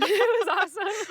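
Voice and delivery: high bubbling laugh through words. Real, not acted. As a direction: giggle the line out. Laughing about eating meat.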